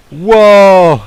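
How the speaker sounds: loud, high burst of amazement; amazed wow. Real, not acted. Player stunned by the new sonic effect, loud amazed wow. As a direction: blurt it out, stunned.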